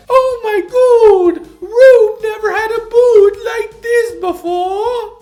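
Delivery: very high, loud, exaggerated foreign accent; high-pitched caricature of a foreign accent; [name]'s accent. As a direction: squeal it out in a hammed-up, unplaceable accent. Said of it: "I don't know what that accent is".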